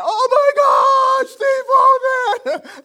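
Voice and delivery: high, loud shriek of recognition, laughing; starstruck shout. Retold or imitated. Pastor recognizing the famous man, excited shriek. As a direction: scream the name in starstruck delight. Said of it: "And I'm like".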